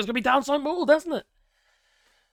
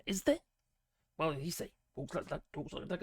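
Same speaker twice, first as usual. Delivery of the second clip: usual speaking voice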